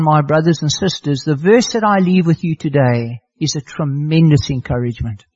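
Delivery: ordinary delivery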